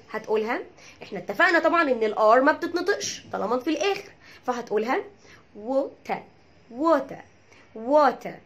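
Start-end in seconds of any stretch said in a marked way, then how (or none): 5.6-8.3 "ووتر" in British accent with crisp t and silent r, "WO-ta"; British accent water. Shown as a demonstration. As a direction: model British "ووتر" ending in an open "ta". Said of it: "دي بالبريتش اكسنت".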